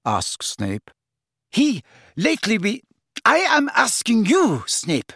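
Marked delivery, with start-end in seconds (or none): 1.5-5.1 very high, insistent demand; stumble, then insist loudly on an answer; high-pitched, exasperated villainess; Bellatrix, flustered anger. Acted.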